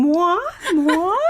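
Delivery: high-pitched, hammed-up diva puppet; Miss Piggy. Quoting a puppet's catchphrase, imitated diva voice. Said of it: "Miss Piggy always saying".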